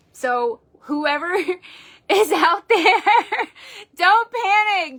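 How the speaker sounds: slow, high, laughing and crying; moved to tears, still reassuring; real emotion, high and slow with laughs; laughing through tears. Real, not acted. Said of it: "I'm sitting here crying".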